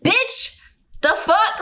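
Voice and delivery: high-pitched incredulous outburst; exasperated disbelief. Retold or imitated. Woman reacting to her date's dodge, exasperated. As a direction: pitch it way up, half laughing.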